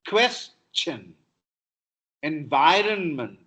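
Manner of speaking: normal talking voice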